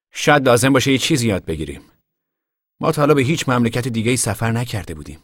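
a son suggesting they adapt; lightly voiced Tom. Tom answering Uncle John, a lightly voiced young man.

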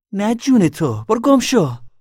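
very high, loud dismissal; shrieked refusal in a quarrel; angry yell. A narrator's reading. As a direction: shout him away furiously. Girl yells him away.

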